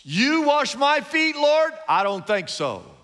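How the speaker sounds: defiant. Retold or imitated. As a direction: push back at the Lord, defiant. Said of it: "a stance of defiance".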